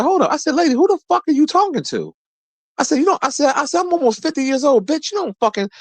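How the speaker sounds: high, heated mock tirade; retold angry outburst. Him cursing out the rental car lady, high angry outburst.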